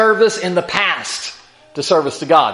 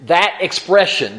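ordinary talk

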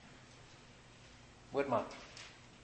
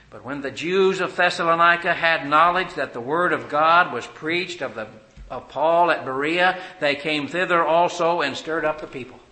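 loud, higher, driving sermon voice; full-force preaching; 4 semitones higher, 11 dB louder than usual. Acted. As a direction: hammer the verse at the congregation.

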